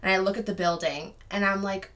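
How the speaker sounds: usual speaking voice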